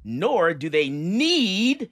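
NEED stretched long, high and loud; slow, loud swell onto NEED; all-caps emphasis. Acted. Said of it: "in all caps".

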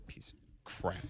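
whispered under the breath; muttered whisper. Real, not acted. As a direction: hiss it to yourself. Muttering at broken mic.

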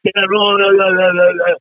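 high, drawn-out mock of a frantic daughter; daughter going berserk. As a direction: imitate the daughter losing it over the post. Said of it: "got my daughter going frickin' berserk".

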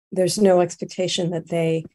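ordinary delivery

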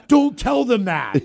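high, loud, slow plea; wife's loud mock protest; embarrassed protest. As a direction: beg him not to tell, laughing. Wife scolds, embarrassed.

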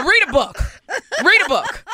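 high-pitched mock scolding, punched short; Barbara Walters. Comic telling how her mentor ended talks, mock scolding. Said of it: "she go".

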